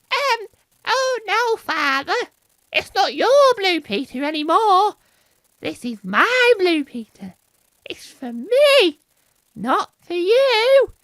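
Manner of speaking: put-on very high, loud, slow squeaky kid; squeaky child. Child telling father off, loud squeaky put-on voice. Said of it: "this is my impression of a squeaky voice".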